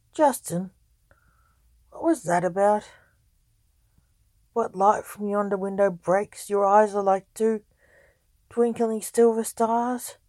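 loud mocking shout of the verse. A narrator's reading. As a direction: throw the line back at him, loud. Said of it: "shouted the ghost".

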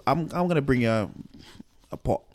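ordinary delivery

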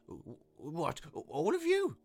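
stammer, then leap high and loud; aghast, high-pitched disbelief. A narrator's reading. Small man faced with the whole gang, aghast. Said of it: "aghast by everything he was seeing and hearing".

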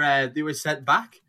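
usual speaking voice

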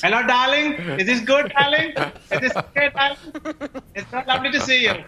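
high-pitched imitation of his mum's accent; mum impression. Comedian's mum checking her Zoom call, in a high put-on mum's accent. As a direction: put on mum's voice, lifted way up with rising questions. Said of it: "when he did his mum's accent".

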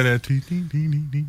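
soft "ti ti ti" tune mimicry; imitated background music. Contestant asks host for the familiar background music, soft imitation. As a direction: quietly mimic a quiz music bed. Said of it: "la musique en, en arrière, là".

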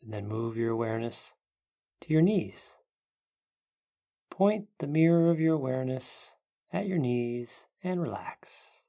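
guided meditation. Acted. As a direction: ease them into calm, unhurried.

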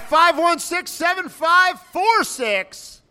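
slow, drawn-out number calling; raffle announcer. Real, not acted. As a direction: stretch out every number.

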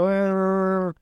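a touch of a lazy dude's drawn-out ouais; mock slacker voice. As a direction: lightly mimic a know-it-all guy. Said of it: "commence pas à faire le gars". (imitation légère d'un gars trop sûr de lui)

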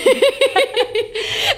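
high burst of laughter; real laugh. Real, not acted. Laughing while retelling.

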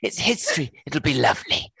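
starts very high and chirpy, settles down; a breezy mother brushing off her child's fear; mock-posh cheerful mum, high then easing; airy mum. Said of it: "She's like".

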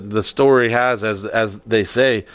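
ordinary talk, nothing marked

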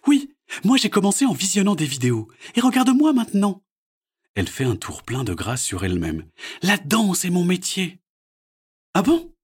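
high, lively dialogue voices; character voices. A narrator's reading. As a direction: dancer encourages the girl. Sophie and Mélanie answering. (voix de personnages aiguës)

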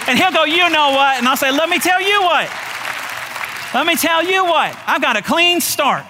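high, full-voiced preacher's declaration; impassioned, emphatic pulpit rebuttal; defiant preaching. Real, not acted. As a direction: fire it back at the devil. Preaches reply to devil.

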